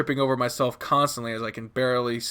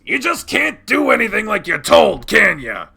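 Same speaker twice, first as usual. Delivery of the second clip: loud, higher-pitched angry scolding; raised voice; 8 semitones higher, 7 dB louder than usual. Acted. Dad losing patience with child, raised voice. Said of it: "Dad's voice raises".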